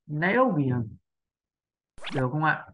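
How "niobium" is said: "Niobium." stress on "o", clear falling ending; native stress. Shown as a demonstration. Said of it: "tập trung trọng âm như thế". (đọc "Niobium." như người bản xứ)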